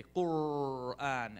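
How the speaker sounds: "Qur'an" very slow with a long growled R; grizzly bear growl. Shown as a demonstration. Teacher urging student to do it, bear-growled "Qur'an". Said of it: "like a grizzly bear".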